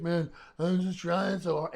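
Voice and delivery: slow, high, loud mock wail; mock crying. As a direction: imitate a fan tearfully pouring his heart out. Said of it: "he starts, like, crying".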